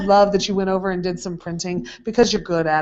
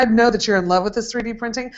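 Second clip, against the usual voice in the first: normal talking voice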